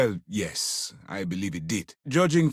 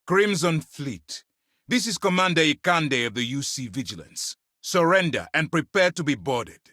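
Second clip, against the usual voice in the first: raised, projected military ultimatum; high-pitched, formal demand for surrender; commanding hail; 5 semitones higher than usual. Acted. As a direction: announce it firmly to an enemy fleet. Commander hails enemy fleet, demanding surrender.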